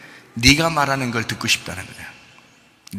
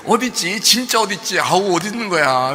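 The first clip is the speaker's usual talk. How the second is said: higher-pitched pretend searcher; mock frustrated searching; 6 semitones higher than usual. Adult playing hide-and-seek with children, mock frustration. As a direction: play at being unable to find them. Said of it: "막 안타까워하고".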